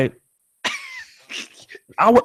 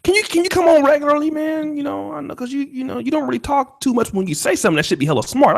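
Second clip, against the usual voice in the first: ordinary talk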